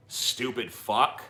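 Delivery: ordinary delivery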